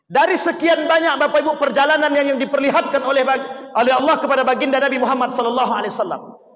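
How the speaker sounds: high, loud pulpit proclamation; fiery preaching. Acted. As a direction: proclaim it to the whole mosque. Preacher tells of the Prophet.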